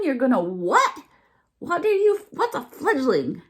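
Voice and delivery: high, loud baffled question; puzzled fawn. A narrator's reading. Fawn puzzled by the bird's fledging, high and loud. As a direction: ask it scrunching up your nose. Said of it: "She wrinkled up her nose and asked".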